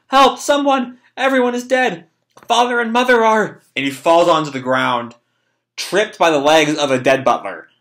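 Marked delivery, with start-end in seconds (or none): 0.1-3.6 high, panicked cry for help; acted freak-out. Boy finds everyone is dead, freaking out. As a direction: call for help in a raised, frantic pitch. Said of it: "he just keeps freaking out".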